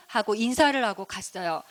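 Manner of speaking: usual speaking voice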